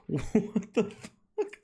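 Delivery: slow, laughing, rising question; laughing disbelief. Real, not acted. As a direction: ask it while laughing. Still laughing at price.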